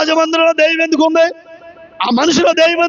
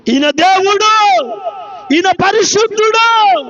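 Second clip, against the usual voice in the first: slow, raised preaching cry; drawn-out retold cry; 4 semitones higher, slower than usual. Preacher cries out the spirit's confession, slow and raised. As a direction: call it out with stretched endings. Demon's words repeated as a cry.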